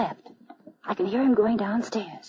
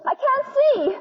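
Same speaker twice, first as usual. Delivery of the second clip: high, panicky calling; scared, straining to find him; wife terrified in the pitch-dark cellar; frightened; 13 semitones higher than usual. Acted.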